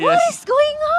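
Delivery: high, loud disbelieving yell; excited shout. Real, not acted. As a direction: shout it out in amazement. Caster exclaims at triple kill.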